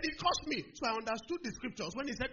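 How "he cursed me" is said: high-pitched mock complaint; very angry. Big student complaining to everybody, high mock outrage. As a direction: wail it as the aggrieved bully. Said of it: "the guy got very angry".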